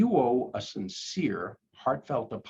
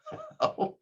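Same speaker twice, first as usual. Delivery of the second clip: high laugh; 4 dB quieter than usual. Real, not acted. Laughs at bad apology.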